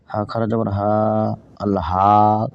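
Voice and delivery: usual speaking voice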